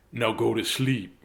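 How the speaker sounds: lightly voiced firm dad; a hint of a stern father. Dad sending his son to sleep, a hint of firmness. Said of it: "his father said".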